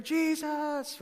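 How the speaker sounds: high, drawn-out cheer of a name; mock crowd cheer. Preacher imitating the crowd as Jesus enters the city, mock cheering. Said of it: "the crowds are cheering".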